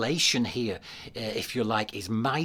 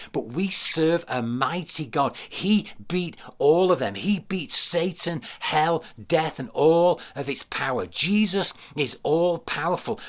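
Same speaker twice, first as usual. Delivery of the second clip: emphatic, fervent proclamation; impassioned preaching; 3 semitones higher than usual. Real, not acted. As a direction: drive each declaration home to the congregation. Preaches the climax with force.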